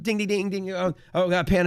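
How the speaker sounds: high, loud mock bell and yells; hammed-up chaos on the desk; frantic trading floor noise. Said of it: "like, you hear on the movies".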